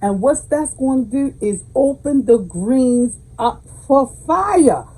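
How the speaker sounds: usual speaking voice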